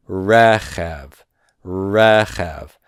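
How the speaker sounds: "rechev" with the first syllable stressed, falling away on the second; non-final stress. Shown as a demonstration. Teacher demonstrating a Hebrew word, first syllable stressed in "rechev". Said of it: "this is non-final stress".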